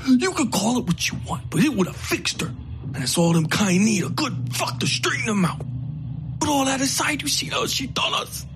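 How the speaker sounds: ugly, self-righteous rant; hateful outlaw. Acted.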